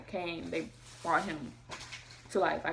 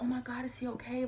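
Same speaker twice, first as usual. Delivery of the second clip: worried, higher-pitched question; retold panic; 6 semitones higher than usual. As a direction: re-enact a mother's alarm, pitched up. Retells her panic at birth.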